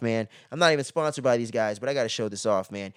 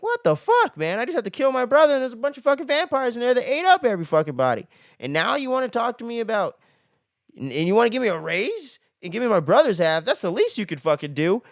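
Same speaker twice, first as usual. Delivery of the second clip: pissed-off Seth; 7 semitones higher than usual. Retold or imitated. Seth ranting at Carlos after killing his brother, pissed off. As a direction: act out an indignant tirade. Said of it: "Seth is pissed".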